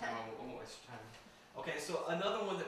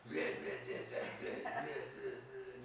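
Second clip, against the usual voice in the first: low garbled grumble, laughing at the end; mock grumbling; 3 semitones lower, 4 dB quieter, slower than usual. Imitating the ghost man on every recording, mock low grumbling. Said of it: "just like grumbling".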